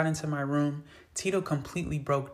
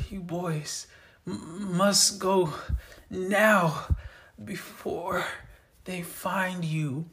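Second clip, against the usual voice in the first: weak and hurting, the last words slowed right down; pained voice; 4 semitones higher, much slower than usual. A narrator's reading. Wounded father begging the boys to leave, pained and weak. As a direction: push the words out through the pain. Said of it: "you could hear the pain in his voice".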